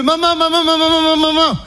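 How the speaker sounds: high-pitched frantic cries for mum; panicked calling. Acted. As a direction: cry out over and over in comic panic. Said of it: "Je fais".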